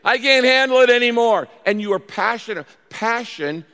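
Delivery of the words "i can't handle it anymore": high, loud cry at breaking point; desperate plea. Acted. Preacher acts out someone at the end of their rope, desperate. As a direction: plead loudly, as if you can't take more.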